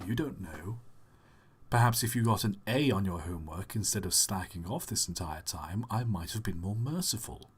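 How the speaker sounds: low, quiet schoolteacher voice; English teacher in disguise. Acted. Teacher chides a slacking pupil, low and quiet English teacher voice. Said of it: "Are you my old English teacher in disguise?".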